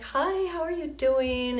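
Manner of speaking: high, slow, sweet lady greeting; a slow, high, friendly neighbour voice; sweet church lady. Retold or imitated. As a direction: voice the kind church lady lightly.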